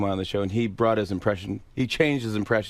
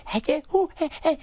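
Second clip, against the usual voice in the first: high-pitched goofy gibberish noises; Adam Sandler gibberish. Acted. Guest demonstrating Sandler's second phase, high gibberish impression. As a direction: babble nonsense in a squeaky comic voice. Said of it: "he does the thing where it's like".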